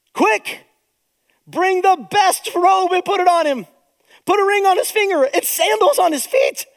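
high, loud, overjoyed father calling to the servants; father calling out to the servants in delight; joyful father. Acted. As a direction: shout the orders for the feast. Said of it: "And the Father says to the servants".

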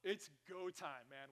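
ordinary talk, nothing marked

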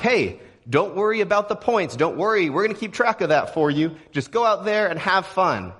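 high, bright mock game-show host reassurance; cheery host. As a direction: ham up the host telling the losing team not to worry. Quoting the game show hosts.

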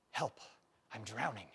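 soft, low, thrown away; quiet. Shown as a demonstration. Preacher quoting a comedian's line, said softly. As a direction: say the cry for help under your breath. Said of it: "The comedian said".

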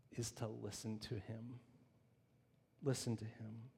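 preacher's quiet emphatic listen to him; hushed command. Acted. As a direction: drop to a near hush.

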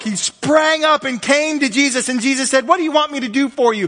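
loud, high, driving preacher's voice; preaching at full force. Acted. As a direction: preach it out, rising and pounding.

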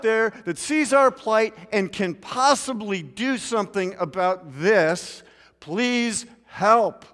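pleading cry for help, loud; desperate plea. Acted. Preacher voicing Israelites' plea, desperate cry. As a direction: beg any god out there for help.

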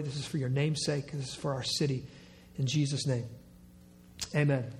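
hushed, low prayer voice; low reverent pastoral prayer. Acted. Opening prayer before sermon.

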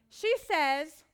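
normal talking voice

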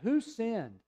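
mild, high-pitched mock accusation; voiced like an affronted accuser; accusing question. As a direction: demand an answer, pitch jumping up. Angry rulers questioning the parents.

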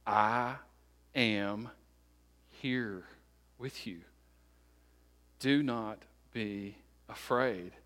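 slow, word by word, with long pauses; solemn proclamation. Acted. Preacher voicing Jesus proclaiming to be God, solemn and measured. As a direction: let each word settle, quietly deliberate. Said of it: "Jesus proclaiming to be God".

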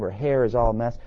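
normal talking voice

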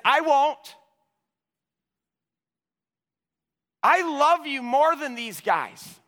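high, loud, emphatic vow; bold protest. Acted. Peter standing up to Jesus among the disciples, bold protest. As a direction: protest loudly with total conviction.

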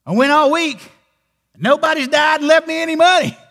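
high, loud, mock-miserable wail; glum lament. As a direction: whine it like a sulking man who got nothing. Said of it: "Why do you look so glum?".

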